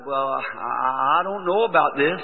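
halting, slow stammer on the start; nervous. Retold or imitated. New preacher unsure at the microphone, nervous and hesitant. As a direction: imitate fumbling for words, unsure. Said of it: "I was fumbling real nervous".

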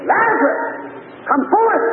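high, ringing summons; commanding call. Acted. Preacher as Jesus summoning Lazarus from the grave, commanding call. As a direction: call it out in two separate, high-pitched bursts.

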